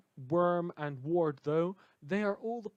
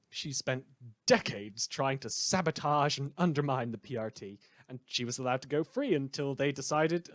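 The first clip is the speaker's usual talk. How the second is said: smug, theatrical broadcaster sneering at his listeners; villainous radio host; 5 dB louder than usual. Acted. A rogue radio host taunts his listeners about killing, in a smug on-air voice.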